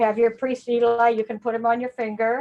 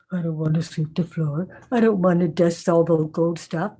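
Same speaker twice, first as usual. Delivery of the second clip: lower, grumbling chores complaint; a hint of a boy refusing chores; whiny boy; 6 semitones lower than usual. Samuel lightly voiced refusing to sweep, grumbling.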